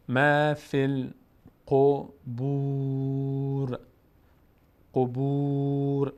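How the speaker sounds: slow, drawn-out chanted Arabic recitation; slow Quran recitation. Shown as a demonstration. Teacher slowly reciting the verse for the student, chanted. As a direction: stretch every syllable as in tajweed. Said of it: "teacher recites".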